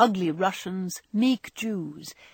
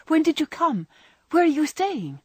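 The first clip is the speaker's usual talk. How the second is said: high, louder reunion questions; eager questioning; 9 semitones higher, 5 dB louder than usual. A narrator's reading. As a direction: pile on eager questions in a lifted voice.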